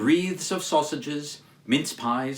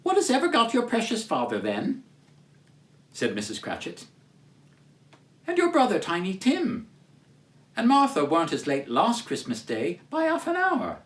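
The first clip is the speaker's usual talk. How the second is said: high, quick fretting mother voice; fussing mother; 4 semitones higher than usual. Acted. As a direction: fret like a busy mother. Mrs Cratchit frets.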